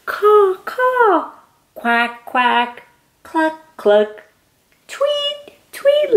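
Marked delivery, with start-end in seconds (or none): 0.1-1.3 high squawking caw caw; crow caw. Shown as a demonstration. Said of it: "make some of these noises". 4.9-6.2 very high chirpy tweet; bird tweet. Shown as a demonstration. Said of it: "make some of these noises".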